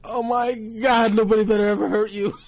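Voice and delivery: hammed-up weepy outburst of a doting uncle; overwhelmed protectiveness. Overcome uncle's mock protective outburst.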